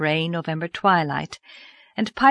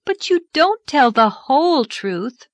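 put-on higher, protesting girl; objecting; 8 semitones higher, 5 dB louder than usual. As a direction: push back firmly. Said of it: "objected Anne".

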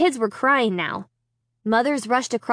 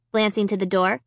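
ordinary delivery

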